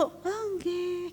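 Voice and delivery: hushed, demure Javanese answer; quiet polite wife's reply; soft refined reply. Retold or imitated. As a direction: answer meekly, barely above a murmur. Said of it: "yang kalem".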